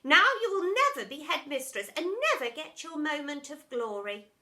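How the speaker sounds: high, loud taunting; mocking. A narrator's reading. Teacher taunting the deputy, high and loud. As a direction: taunt her with fake pity. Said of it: "mocked Miss Epoch".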